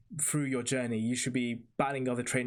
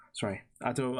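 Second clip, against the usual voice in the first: normal talking voice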